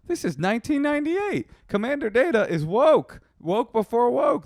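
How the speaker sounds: high, loud, thrilled; gushing with excitement; excited. Retold or imitated. Excited inner quote, high and loud.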